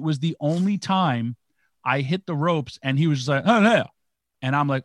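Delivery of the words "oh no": short, high, loud mock holler; sudden high-pitched burst; imitated yell. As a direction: blurt it out as a wrestler's ring shout. Imitates wrestler's cry in the ring.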